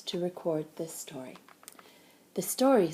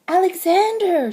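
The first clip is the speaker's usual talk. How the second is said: high loud call of the name; crying out; 10 semitones higher, 9 dB louder than usual. A narrator's reading. Jessie calling for her lost bear, loud and high. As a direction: call out his name in hope. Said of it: "she cried".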